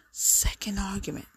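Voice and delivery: whispered repeat of the phrase; whispered aside. Real, not acted. Vlogger whispering an aside to viewers, hushed. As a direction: drop to a whisper for emphasis.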